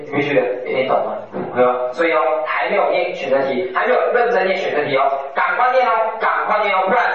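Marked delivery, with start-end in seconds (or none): 5.3-7.0 urging. Real, not acted. Urging students to start studying now.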